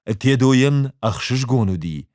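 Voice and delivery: usual speaking voice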